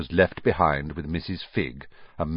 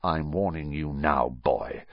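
lower, softer threat; menacing warning; 4 semitones lower, 5 dB quieter than usual. A narrator's reading. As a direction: threaten him up close, low. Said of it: "putting his large purple face right up close".

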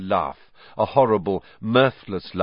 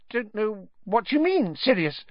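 high, squeaky nervous denial; Pettigrew nervous mutter; 13 semitones higher than usual. A narrator's reading. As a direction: squeak out an evasive protest. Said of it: "muttered Pettigrew".

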